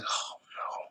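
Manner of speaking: breathy whispered dismay. Retold or imitated. Recapping a wrestling show, whispering his dismay. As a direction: drop to a hushed whisper of disbelief. Quoting own whispered reaction.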